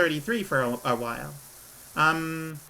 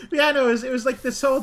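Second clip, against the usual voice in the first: high, louder words through a real laugh; 10 semitones higher, 5 dB louder, faster than usual. Real, not acted. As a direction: laugh your way into the sentence. Laughs at her own age mistake.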